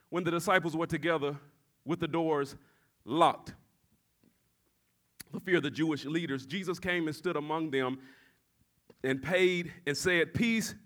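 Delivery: low, soft, measured Bible reading; scripture reading. Real, not acted. Preacher reading John to the congregation, hushed scripture reading. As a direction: read the verse quietly, pausing between phrases.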